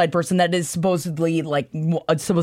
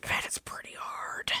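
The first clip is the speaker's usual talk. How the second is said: playful whisper; very soft, naughty aside; 16 dB quieter than usual. Pretend. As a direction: whisper it suggestively, ending in a hushed laugh. Suggestive aside about his chest.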